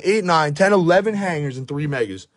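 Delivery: usual speaking voice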